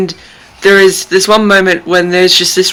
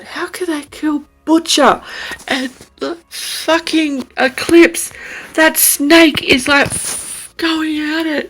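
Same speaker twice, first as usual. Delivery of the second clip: higher, wavering, close to crying; near tears; 8 semitones higher than usual. Real, not acted. Reader grieving a beloved character to viewers, near tears. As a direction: hold back tears as you protest. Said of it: "I'm trying not to cry".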